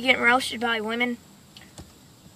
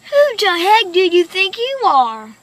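indignant rant; 6 semitones higher, 4 dB louder than usual. Real, not acted. Ranting after the call.

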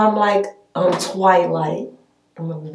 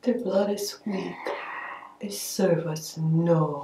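vampire whisper; 8 dB quieter than usual. Acted. Vampire roleplay, whispered creepy voice.

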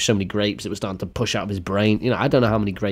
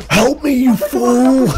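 high, loud, drawn-out cry for help; mock panicked shout; 14 semitones higher, 5 dB louder, much slower than usual. Choking man begs his mate, mock panicked shout. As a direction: shout it slowly, high and desperate.

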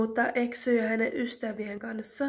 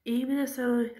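plain reading voice

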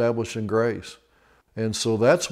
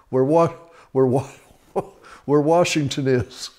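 laughing through words; 4 semitones higher than usual. Real, not acted. Cracking up at own joke.

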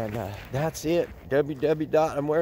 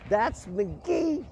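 higher coo naming the dog; pet voice; 11 semitones higher than usual. Real, not acted. Introducing McGee to viewers in a higher pet voice. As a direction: say the dog's name pitched up.

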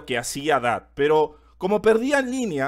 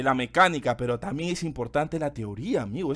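ordinary talk, nothing marked

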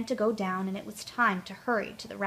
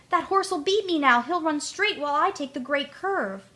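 fretting Tootle; 6 semitones higher than usual. A narrator's reading. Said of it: "cried Tootle".

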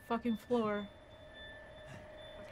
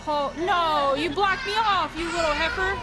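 outraged yell; 7 semitones higher, 9 dB louder, slower than usual. Real, not acted. Blocked by game enemy.

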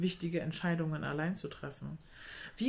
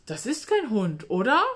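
uneasy surprise; 4 semitones higher, 8 dB louder than usual. Acted. Miriam doubts the animal.

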